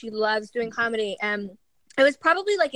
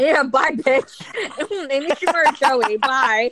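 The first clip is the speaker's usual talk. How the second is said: laughing; 2 semitones higher, slower than usual. Real, not acted. Laughing sassy echo.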